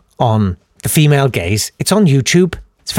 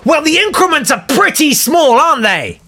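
exasperated retort; 12 semitones higher than usual. Acted. Exasperated reply to Obama's optimism.

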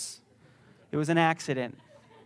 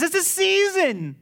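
much higher and louder; God's reassuring voice; 9 semitones higher, 7 dB louder than usual. Acted. Pastor voicing God comforting through death, high and strong. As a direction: lift it up like a comforting promise. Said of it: "He says".

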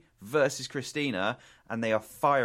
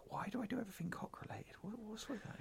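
quick, much softer whispered aside; 12 semitones higher, 14 dB quieter than usual. Real, not acted. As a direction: whisper it to yourself, amused. Self-mocking aside.

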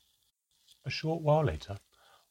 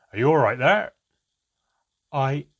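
much louder, higher, booming; loud call; 4 semitones higher, 11 dB louder than usual. Acted. Handelm calling out loudly past the door. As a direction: shout it for the door to hear.

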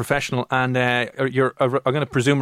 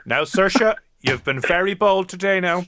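school principal; 6 semitones higher, slower than usual. Acted. Principal to pupil in the office, mock scolding. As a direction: scold like a headmaster, in play. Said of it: "like being in the principal's office".

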